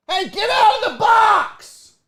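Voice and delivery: high, loud, drawn-out yell at the cat; scolding shout. Real, not acted. Host shouting at cat in the box, high scolding yell.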